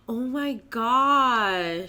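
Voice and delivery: amazed gasp. Real, not acted. Sees picture of raccoon.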